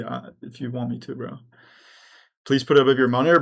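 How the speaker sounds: usual speaking voice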